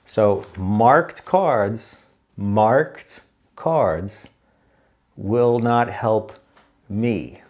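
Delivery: very slow, word-by-word delivery; pointedly slow, as if for someone not following; deliberately slow. Shown as a demonstration. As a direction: drag out each word with pauses between. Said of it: "You gotta talk slow sometimes".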